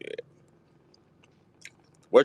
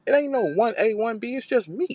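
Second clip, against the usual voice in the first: high, cocky boast in Jazz's voice; high-pitched mocking Jazz impression; imitating Jazz; 10 semitones higher than usual. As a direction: brag like the queen of battle rap, pitched high. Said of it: "she said".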